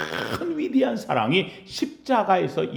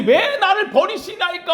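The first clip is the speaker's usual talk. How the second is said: high, louder desperate cry; 13 semitones higher, 3 dB louder than usual. Retold or imitated. Pastor voicing Jesus's cry of abandonment, high and loud. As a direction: call out the why to heaven. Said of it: "하고 외칠 때는".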